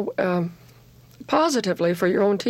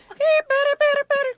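imitating a very high-pitched heckling chant; high-pitched chatter. Imitating a teammate heckling the batter, very high-pitched chant. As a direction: squeal it as a mimicked infield chant. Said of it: "she has a real high-pitched voice".

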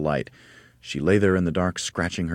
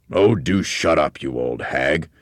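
loud, slow and rude; rude snap; 6 dB louder, slower than usual. Acted. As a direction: tell her to shut up. Husband snaps at wife.

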